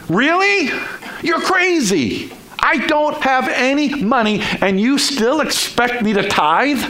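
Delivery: high-pitched, incredulous protest to God; exasperated complaint. Acted. Worn-out believer complaining to God, exasperated and high. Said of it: "You know what that is Christianese for?".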